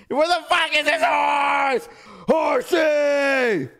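loud desperate calling out; frustrated shout. Real, not acted. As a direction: yell for the lost horse across the map. Searching for lost horse, shouting.

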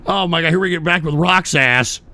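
ordinary talk, nothing marked

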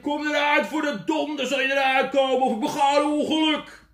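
furious loud high yell; angry shout. Acted. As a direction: threaten the box in a rage. (woedend schreeuwen tegen de doos)

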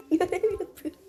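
laughing while mimicking friend; mock his excuse through laughter; laughing mockery. Mocking friend's claim.

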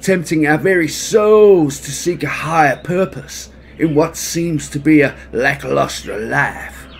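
loud, high, sing-song bear; hammed-up romantic bear; jolly bear voice. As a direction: croon it like a lovesick bear. Baloo's sing-song mango speech.

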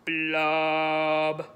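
deep, low blub; one deep low blub; lowest voice. Shown as a demonstration. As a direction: drop as low as you can. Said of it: "in your lowest voice".

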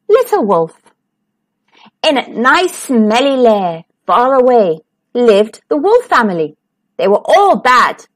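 plain reading voice

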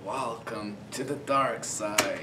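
slow, softened villain welcome; Emperor Palpatine. Acted. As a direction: draw it out like an evil overlord. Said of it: "You wanna try?".

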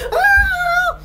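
short high-pitched yell. Acted.

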